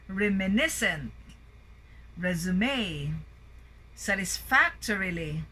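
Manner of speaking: words like "satisfactory" and "supervisory", each peaking on the third syllable; third-syllable stress. Shown as a demonstration. Teacher reading out a word list, stress on the third syllable. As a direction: punch syllable three, as in remiNIScent, theraPEUtic. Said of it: "primary stress on the third syllable".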